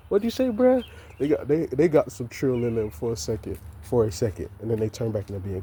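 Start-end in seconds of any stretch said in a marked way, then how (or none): 0.1-0.8 high, loud disbelieving question; incredulous challenge. Retold or imitated. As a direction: demand he repeat himself, stunned. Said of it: "It's like".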